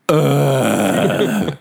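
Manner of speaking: long, drawn-out imitated groan; droning groan. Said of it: "watch it with this sound".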